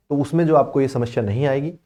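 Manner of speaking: usual speaking voice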